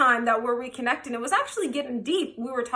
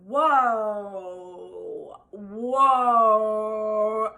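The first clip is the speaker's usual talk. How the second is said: long, drawn-out whoa sliding down; stunned whoa; 3 semitones lower than usual. Retold or imitated. Side chick facing sudden drama, stunned. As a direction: stretch it out slowly, taken aback.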